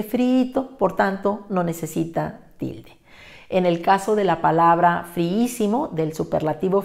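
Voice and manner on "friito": friito, slow, stress on the middle i; stress demo. Shown as a demonstration. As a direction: say friito slowly, leaning on the second i. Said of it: "ya dice".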